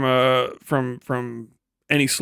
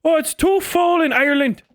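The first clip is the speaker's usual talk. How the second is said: put-on old Irishman accent; 9 semitones higher than usual. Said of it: "I was reminded of this one".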